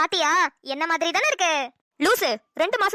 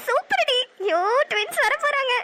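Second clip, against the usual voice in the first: thrilled; 5 semitones higher than usual. Acted. Sister thrilled about twins.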